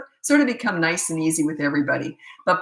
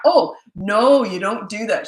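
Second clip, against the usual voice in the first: showy scolding; 3 semitones higher, 5 dB louder, slower than usual. Retold or imitated. Mother to her child for an onlooker, showy mock scolding. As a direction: put on a show of telling off. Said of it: "She put on a show".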